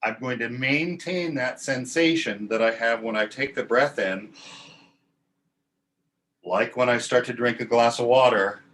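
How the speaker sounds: ordinary talk, nothing marked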